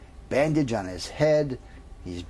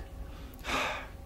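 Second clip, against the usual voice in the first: 1 long sigh; 10 dB quieter than usual. Acted. Comic pause before punchline.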